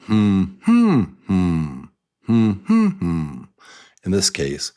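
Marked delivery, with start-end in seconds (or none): 0.0-3.4 hummed tune of a word; humming the stress pattern. Shown as a demonstration. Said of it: "We can even hum it".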